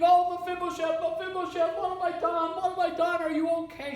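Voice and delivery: put-on a nanny screaming after dropping the child; panicked scream. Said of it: "And she's screaming".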